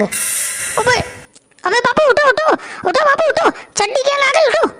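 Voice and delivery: high frantic calls; panicked waking. Acted. Son waking his father as the gang breaks in, high and frantic. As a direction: shake him awake in a panic.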